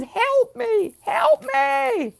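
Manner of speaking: high panicked screams for help; shrill frantic cry, top of the lungs; screaming for help. Retold or imitated. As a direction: scream it like you're being attacked. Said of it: "he's screaming at the top of his lungs".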